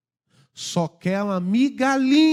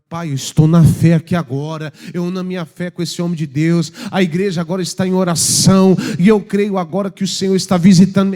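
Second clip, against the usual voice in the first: louder, fervent prayer out loud; earnest prayer; 5 dB louder than usual. Shown as a demonstration. Preacher shows how to pray in faith, fervent prayer. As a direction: pray it with conviction. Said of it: "É assim".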